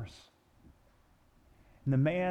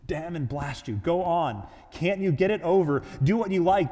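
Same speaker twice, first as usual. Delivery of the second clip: building to a loud, higher bellow; 4 semitones higher, 6 dB louder than usual. A narrator's reading. Ghost giving in to the angel, exasperated bellow. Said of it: "bellowed the ghost".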